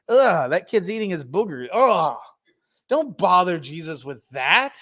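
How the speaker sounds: disgusted ugh, lightly hammed up; mock disgust. Disciples shooing parents away from Jesus, mock disgust. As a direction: sneer like a fussy disciple.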